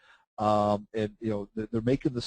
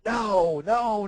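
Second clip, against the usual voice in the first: mock panic; 9 semitones higher, 3 dB louder than usual. Panicked trader pleading.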